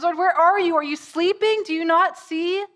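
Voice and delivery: high, loud, urgent questioning; desperate plea. Acted. Believers calling to the Lord in their storms, desperate plea. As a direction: cry out to a God who seems asleep.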